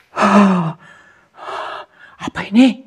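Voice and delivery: loud, high startled question; shocked. Real, not acted. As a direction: gasp it out in alarm. Shocked by what appears.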